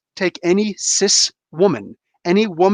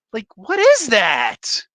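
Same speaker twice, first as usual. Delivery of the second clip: mock terrified gasp; 4 semitones higher, slower than usual. Mocking a cowering man facing a robber, hammed-up gasp. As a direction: gasp like a frightened coward.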